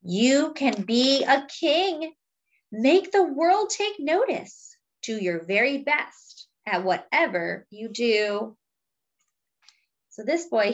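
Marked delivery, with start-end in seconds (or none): 0.0-8.6 slow, weighty refrain then lively lines; storybook reading with a slow refrain; reading to children. Acted. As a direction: read the book aloud to the class. Reading picture book to children.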